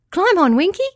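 high, loud chirpy star voice; eager little star calling out; chirped. A narrator's reading. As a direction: chirp it out brightly like a tiny star. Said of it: "chirped the star".

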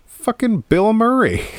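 thrilled high "Bill Murray"; excited recognition. Retold or imitated. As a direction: shout out recognizing him. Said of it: "I was like".

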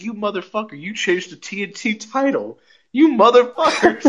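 high, half-laughing mock outrage; mock angry cursing. Fan mock-cursing a wrestler for changing the title. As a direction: curse him out in playful fake fury. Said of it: "I was like, I was like".

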